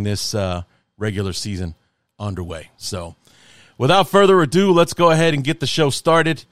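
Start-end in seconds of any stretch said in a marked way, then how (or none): 3.8-6.4 loud, raised announcer intro; show intro. Acted. Host opening his podcast episode for listeners, announcer voice. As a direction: kick off the episode like a host on air.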